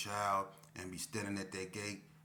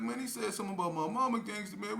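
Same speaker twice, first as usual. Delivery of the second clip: high, fast put-on complaint; telling on him to a friend; aggrieved K P; 12 semitones higher, 5 dB louder, faster than usual. K P to Gangsta about his mother, high aggrieved voice.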